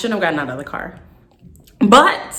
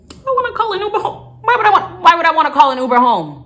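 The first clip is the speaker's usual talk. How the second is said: incredulous scoff; 9 semitones higher, 4 dB louder, faster than usual. Real, not acted. Scoffing at friend's Uber offer.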